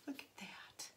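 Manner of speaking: hushed and charmed, almost no voice; awed whisper. Real, not acted. Vlogger showing viewers the eye mask, hushed whisper. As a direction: whisper with delight over the gift.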